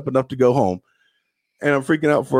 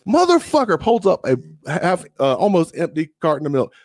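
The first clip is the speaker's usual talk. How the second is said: ordinary delivery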